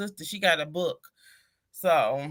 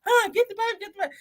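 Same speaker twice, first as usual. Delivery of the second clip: mock panic; 14 semitones higher than usual. Begging daughter to take baby.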